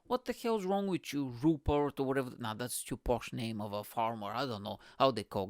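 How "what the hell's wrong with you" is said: ordinary talk, nothing marked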